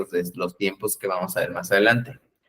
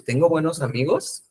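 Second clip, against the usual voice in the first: "Tengo buenos amigos" pitched higher, rising at the end; "Tengo buenos amigos" as a higher, rising self-question; questioning intonation; 5 semitones higher than usual. Shown as a demonstration. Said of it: "no se han preguntado a veces eso".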